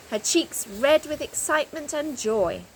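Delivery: ordinary delivery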